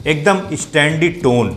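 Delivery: normal talking voice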